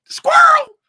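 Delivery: very high, loud comic yelp; mock distracted cry. Streamer mocks own wandering attention, high loud yelp. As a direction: blurt it as a squealed interruption.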